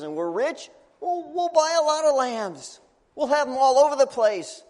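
high and loud, fed up; can't you hear us, fed up; exasperated. Acted. Dad tells the priest again they are poor, high and fed up.

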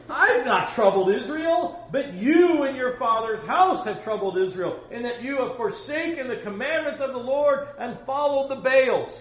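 high, bold shouted rebuke; Elijah yelling back. Acted. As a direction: yell the accusation back across the valley. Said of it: "These two guys yelling at each other".

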